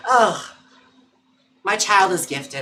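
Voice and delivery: ordinary talk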